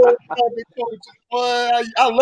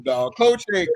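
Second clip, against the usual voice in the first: ordinary delivery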